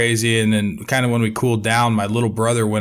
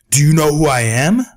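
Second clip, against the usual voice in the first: higher, louder, self-important challenge; pompous demand; 5 semitones higher, 6 dB louder than usual. Retold or imitated. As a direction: puff up like an official. HOA president pulls rank.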